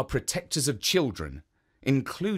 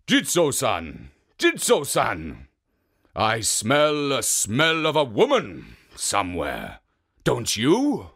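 suspicious ogre; 5 dB louder than usual. Acted. Oni smelling a woman nearby, loud and suspicious. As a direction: call out suspiciously like a hungry ogre. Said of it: "cried out".

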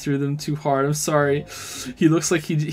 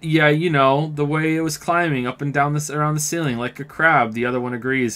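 ordinary talk

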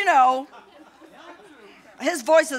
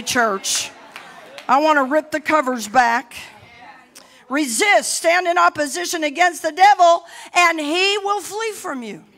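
ordinary talk, nothing marked

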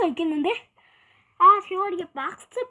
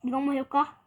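ordinary talk, nothing marked

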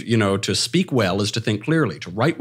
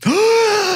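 high, drawn-out shocked gasp; mock gasp; 6 dB louder than usual. Co-host reacting to swearing on air, mock shock. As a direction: gasp in exaggerated scandal.

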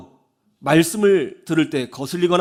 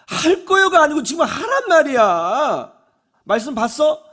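high, loud demand; re-enacted exasperated scolding; nagging mother; 7 semitones higher, 5 dB louder than usual. Mother demands her son do it right now, nagging. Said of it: "잔소리를 막 쏟아내요".

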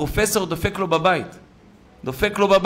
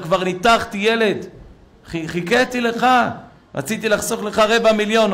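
raised, high-pitched reproach; exasperated professor; 7 semitones higher than usual. Retold or imitated. Professor tells the father he waited for him, exasperated. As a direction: scold him for the chance he missed.

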